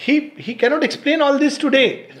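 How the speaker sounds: high, loud, emphatic wonder; real amazement. Real, not acted. Parent marvelling at what the child once said, amazed. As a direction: insist on how astonishing it is.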